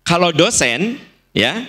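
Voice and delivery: usual speaking voice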